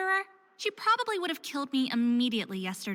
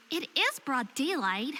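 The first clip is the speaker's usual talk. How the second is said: ordinary talk, nothing marked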